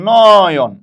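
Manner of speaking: "নয়ন" with a loud, long-drawn first syllable; teacher sounding out "নয়ন" slowly and loudly; pronunciation demo. Shown as a demonstration. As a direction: stretch the first vowel of "নয়ন" right out. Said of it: "কেমনে আমি এটা করবো".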